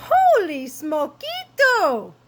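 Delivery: high, loud, drawn-out kitten cry; excited exclamation. A narrator's reading. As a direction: exclaim it big and slow. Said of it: "exclaimed Skippy John Jones".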